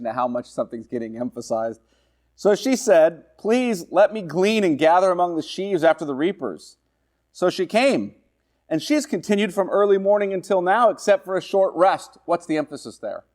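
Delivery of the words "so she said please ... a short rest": higher, deliberate reading voice; scripture reading. Acted. Preacher reading Ruth's plea to the class. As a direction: read Ruth's request with weight.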